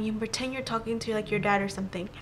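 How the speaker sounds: soft, low, secretive coaching; retold under-the-breath direction; hushed instruction. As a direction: slip the plan to a friend quietly. Secretly coaching friend to pretend.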